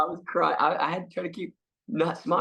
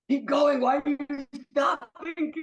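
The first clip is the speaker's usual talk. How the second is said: a lightly voiced Tommy, pitched up; mock Tommy pushing the scene on, higher; Tommy staying in character; 10 semitones higher, slower than usual. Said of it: "He's still in character".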